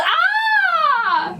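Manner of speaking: long, high, loud "ah" scream; startled yell. A narrator's reading. Father turns round to the boo, startled yell. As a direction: yell out in fright, high and held. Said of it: "His father turned around and yelled".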